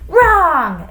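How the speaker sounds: high, loud, drawn-out cry; exclaimed. A narrator's reading. Teacher pouncing on a wrong answer, exclaiming. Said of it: "exclaimed Missus Jules".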